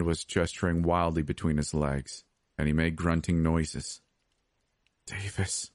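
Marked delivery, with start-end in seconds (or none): 5.1-5.7 soft, weak call; hushed plea for rescue; whispered. A narrator's reading. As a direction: breathe the name softly. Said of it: "I whispered".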